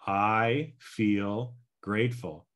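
slow, deliberate I feel grateful; slow affirmation. Shown as a demonstration. Coach demonstrating the ten-by-three affirmation slowly. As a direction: say it slowly, calmly, with conviction. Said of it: "so here we go again".

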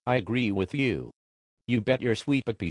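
ordinary talk

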